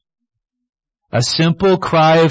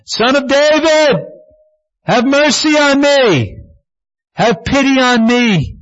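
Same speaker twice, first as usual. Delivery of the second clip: screaming out; 11 semitones higher than usual. Retold or imitated. Blind man crying out high and desperate to Jesus. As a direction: cry out for mercy from the roadside. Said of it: "He started screaming out".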